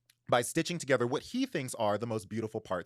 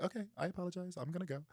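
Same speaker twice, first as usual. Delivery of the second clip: soft, timid apology, much softer; lightly voiced shy creature backing away; meek creature voice; 7 semitones higher, 7 dB quieter, faster than usual. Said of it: "homie's like".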